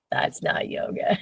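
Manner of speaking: a soft unvoiced aside, slowly; whispered aside. Real, not acted. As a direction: whisper it like a confession to the room.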